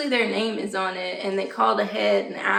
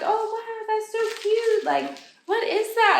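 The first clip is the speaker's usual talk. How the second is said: high, gushing mock voice of a friendly shopper; gushing store lady; 11 semitones higher than usual. Shopper admiring the little church, in a high gushing voice. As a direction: squeal it like a delighted stranger.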